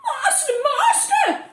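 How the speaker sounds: very high, rising to a loud shriek; high shrieking cry for master; shrieking harp. A narrator's reading. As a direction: squeal out for help like a frightened magic harp. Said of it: "but the harp shrieked".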